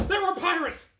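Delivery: high, loud breathless report; mock-excited cashier telling security about pirates. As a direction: squeal it like a thrilled witness. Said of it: "what she said".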